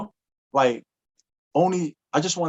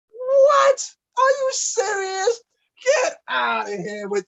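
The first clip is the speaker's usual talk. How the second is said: very high, tearful squeal; overwhelmed crush; slower than usual. Retold or imitated. Girl hearing a love poem, squealing, near tears. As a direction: shriek it out as if moved to tears. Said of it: "she was like".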